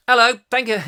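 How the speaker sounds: a light cockney greeting; lightly voiced Kevin. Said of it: "And that's Kevin".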